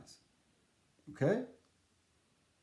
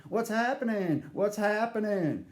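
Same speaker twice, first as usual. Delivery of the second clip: a touch of a lively, upbeat greeter; animated greeter; 4 semitones higher than usual. Retold or imitated. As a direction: mimic the greeter's cheerful call, lightly. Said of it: "and he's all animated".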